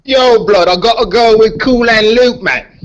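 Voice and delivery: a put-on British accent; lightly played British accent. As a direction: slip into a British accent for the pick. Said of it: "that's a good accent".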